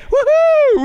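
high, loud "woohoo"; sudden high-pitched holler; whooping cheer. Pretend. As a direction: let out a big mock-celebration whoop. Mock cheer for Sin City.

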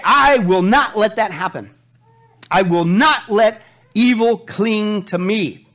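slow, loud, firm I will not; emphatic vow. Acted. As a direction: vow it slowly and hard. Paraphrased scripture with force.

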